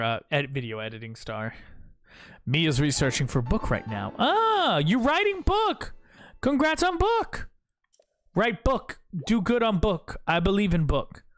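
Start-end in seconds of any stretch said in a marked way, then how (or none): 4.2-11.2 high-pitched dumb caveman voice; caveman talk. Pretend. Streamer cheering Mia's book in high caveman speak. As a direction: play it like a simple caveman cheering.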